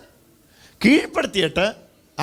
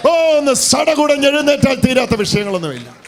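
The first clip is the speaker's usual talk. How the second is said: far higher, louder preaching cry; fervent shout; 6 semitones higher, 5 dB louder than usual. Real, not acted. Pastor firing up the church, fervent shout. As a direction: blaze it out at the top of your voice.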